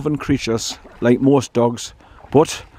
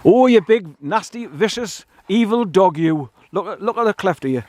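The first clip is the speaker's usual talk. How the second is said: mock-scolding the dog; 6 semitones higher than usual. Real, not acted. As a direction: call him evil with obvious fondness. Mock insults while petting dog.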